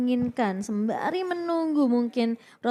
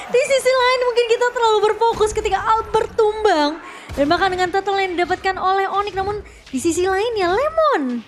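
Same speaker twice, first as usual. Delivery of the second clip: fighting game announcer; 7 semitones higher, 6 dB louder than usual. Acted. Co-caster hyped commentary.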